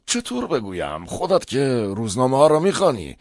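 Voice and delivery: uneasy father pushes the words out; upset. A narrator's reading. Father hints awkwardly at the bad reviews, upset. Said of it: "با ناراحتی گفت".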